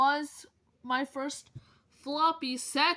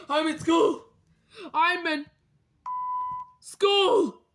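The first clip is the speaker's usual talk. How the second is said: excited; 7 semitones higher, 6 dB louder than usual. Pretend. Toy character announces excitedly.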